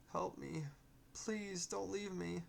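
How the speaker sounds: high, desperate pleading spirit voice; pleading ghost. Acted.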